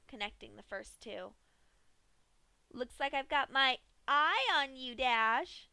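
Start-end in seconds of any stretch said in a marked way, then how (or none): 2.7-5.7 high, bright giggling threat; teasing the helpless victim sweetly; giggly Pinky voice. A narrator's reading. Pinky teases Dash on the table, giggly and high. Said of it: "Pinky giggled".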